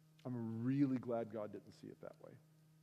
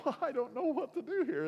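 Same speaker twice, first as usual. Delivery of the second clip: high-pitched helpless protest; exasperated; 11 semitones higher, 4 dB louder than usual. Retold or imitated. Boyfriend stuck in an argument with his girlfriend, exasperated. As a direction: throw up a louder, higher mock-exasperated plea.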